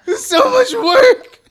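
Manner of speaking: slow, high, loud laughing wail; mock-despairing wail. Real, not acted. Podcaster lamenting the edit ahead, mock-despairing wail. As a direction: wail as if crushed by the workload.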